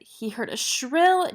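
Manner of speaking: plain reading voice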